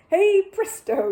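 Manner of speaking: slow, high, loud hey presto; magic flourish. A narrator's reading. Bear pulling the handkerchief away, triumphant slow flourish. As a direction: stretch it out like a stage magician.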